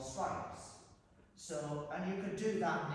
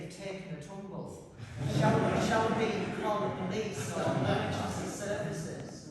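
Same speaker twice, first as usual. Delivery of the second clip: a mock smartwatch voice rising loud on the question; 2 semitones higher, 7 dB louder than usual. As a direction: play the watch, loud and mechanical. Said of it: "my watch keeps saying".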